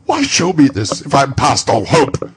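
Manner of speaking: put-on desperate old man's plea; pleading Scrooge. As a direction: beg for a second chance.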